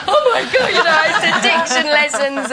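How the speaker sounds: high, loud mock dismay; hammed-up upset mother, laughing through it. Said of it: "she was a little bit upset".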